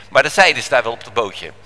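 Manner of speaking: ordinary delivery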